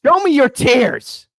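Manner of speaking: slow, high, loud demand; mock demand. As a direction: drag out the challenge loudly. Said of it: "If you're crying, where are the tears?".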